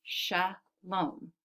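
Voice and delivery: "shalom" split as sha, pause, lom; syllable by syllable. Shown as a demonstration. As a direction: clap out "shalom" in two separate beats. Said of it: "I hear two syllables".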